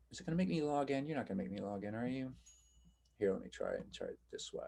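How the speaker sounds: muttering to self. Real, not acted. Teacher to the computer asking for a login, muttering to self. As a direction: quietly grumble through a tech snag.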